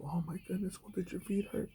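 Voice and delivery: high, soft, mock-fussing voice; high pitched mock worry over sore feet; fussing civilian. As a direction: gush with exaggerated concern. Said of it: "I hear a lot of".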